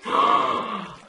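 high, loud gasp of surprise; sharp gasp. Real, not acted. Gamer spotting possible diamonds, startled gasp. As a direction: gasp in sudden amazement.

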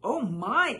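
Aunt Sassy exclaims at the snow; exclaiming. A narrator's reading. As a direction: gasp at the falling snow. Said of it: "exclaimed Aunt Sassy".

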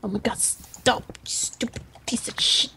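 whispered curse at the game; whispered cursing. Real, not acted. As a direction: hiss it under your breath. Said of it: "Talking to the game, yelling at it".

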